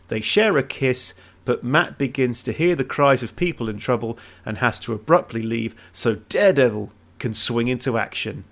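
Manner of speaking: ordinary talk, nothing marked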